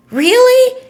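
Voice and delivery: high, loud, drawn-out disbelief; incredulous. Real, not acted. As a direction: exclaim it in sharp disbelief at the idea. Indignant reaction to policing of jokes.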